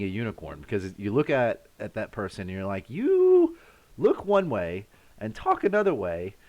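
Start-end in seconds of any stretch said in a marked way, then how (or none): 2.9-6.3 high, drawn-out "you", then puzzled wonder; imagined onlooker marvelling, pitched way up at first; mock amazed reaction. Streamer imagines meeting someone unexpected, mock amazed.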